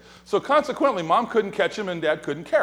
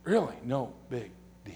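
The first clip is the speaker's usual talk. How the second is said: quiet aside; 6 semitones lower, 9 dB quieter than usual. Real, not acted. Dry aside repeat.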